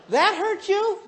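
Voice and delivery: high-pitched, squeaky-high incredulous question; astonished. Retold or imitated. Husband repeating the question to wife, high and incredulous. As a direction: pitch it way up in disbelief.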